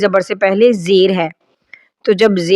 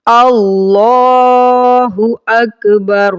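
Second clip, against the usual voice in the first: slow, drawn-out Arabic recitation; 3 semitones higher, 3 dB louder, much slower than usual. Shown as a demonstration. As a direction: recite each word slowly and clearly. Teacher recites prayer slowly.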